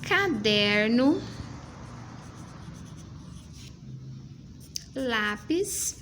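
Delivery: Lapiseira, caderno, lápis each drawn out slowly with rising ends; slow dictation. Shown as a demonstration. Teacher reading the word list slowly for the child. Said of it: "ó".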